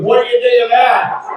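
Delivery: high-pitched taunting yell; mock road-rage yell. Driver finishing his threat to the comedian, mock yell. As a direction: throw it down as a challenge. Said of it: "he yells at me".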